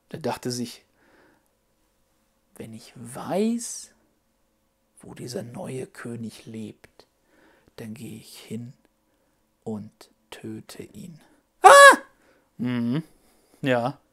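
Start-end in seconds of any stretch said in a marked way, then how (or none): 2.5-11.2 slow, much softer scheming king; a villain whispering a secret plan; sinister quiet. Acted. Narrator voicing Herod's plan. 11.6-12.0 very high, loud puppet shriek; a sudden fright; shocked scream. Acted.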